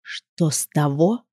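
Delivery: slow, soft, low poisonous hiss; venomous rustle. Acted. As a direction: drag it out, dripping venom. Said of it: "ядовитым голосом прошелестел Маркус".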